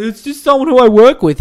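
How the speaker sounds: high, loud imitation of her brushing it off; mock dodgy fiancee. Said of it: "behaving this way and being like".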